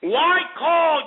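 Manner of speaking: high, drawn-out angry demand; exasperated outburst. Real, not acted. Host scolding a silent caller, exasperated outburst. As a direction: pitch it up in exasperation.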